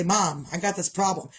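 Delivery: usual speaking voice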